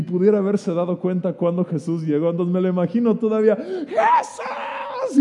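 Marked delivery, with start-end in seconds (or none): 3.6-5.2 one high desperate yell; desperate shout. Retold or imitated. Beggar still screaming for Jesus. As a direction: scream the name as if he can't see him. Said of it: "este hombre gritando desesperado".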